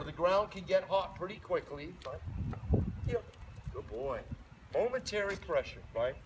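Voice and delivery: ordinary talk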